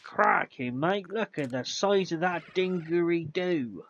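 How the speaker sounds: playing Steve Irwin, a touch of Australian; mock Aussie. Gamer greeting a Pokemon in mock Aussie accent. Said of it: "pretending to be Steve Irwin".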